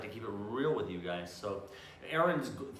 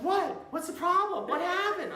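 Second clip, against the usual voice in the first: high, loud innocent wife voice; imitating his wife; 6 dB louder, slower than usual. Mocking his wife acting innocent about his nose, high and loud. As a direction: play her wide-eyed innocence. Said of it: "she's like".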